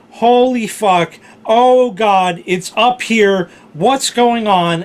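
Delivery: high, loud, slow frightened exclamations; panicked. Retold or imitated. Finding the purifier whirring red upstairs, loud panicky. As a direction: drag out each word in shock. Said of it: "I said".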